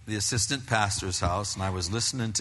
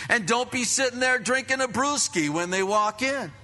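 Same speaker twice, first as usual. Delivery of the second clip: high, loud driving sermon; fervent preaching; 13 semitones higher, 4 dB louder than usual. Acted. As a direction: press the warning home to the church. Preaching at full force.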